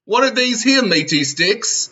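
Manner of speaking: hammed-up fair vendor, mock puzzled; comic vendor. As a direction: draw out the question in disbelief. Improvised Renaissance fair scene.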